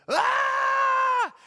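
high, loud imitated child's scream. Preacher recalls son upstairs, imitating a high loud scream. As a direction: mimic the kid's sudden yelp from upstairs.

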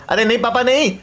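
son's high, chatty voice; eager son. Acted. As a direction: play the excited kid calling out to papa.

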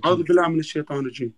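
fast, running the Arabic words together; rapid recitation. Shown as a demonstration. Mo repeating the prayer his friend asked for, rapid recitation. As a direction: repeat the prayer back quickly as told.

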